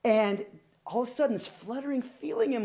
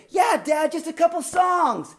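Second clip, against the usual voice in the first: daughter chiming in; 8 semitones higher, 6 dB louder than usual. Retold or imitated. Daughter jumps in pleading.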